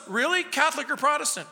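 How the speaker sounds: high, quick, excited questions; mock-excited high quizzing; eager curious. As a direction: pounce on the detail.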